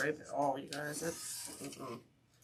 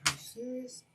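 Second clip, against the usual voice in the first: incredulous; 7 semitones higher, 6 dB quieter than usual. Retold or imitated. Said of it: "I'm like".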